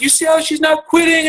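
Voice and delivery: high-pitched, worked-up dad lecture; mock emotional, voice way up high. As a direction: squeal out a lesson like an overemotional dad. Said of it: "I'm all emotional. I'm giving leadership lessons".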